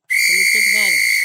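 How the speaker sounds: loud hopeful yell at the screen; excited shout. Real, not acted. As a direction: yell it like the game depends on it. Shouting at hockey game.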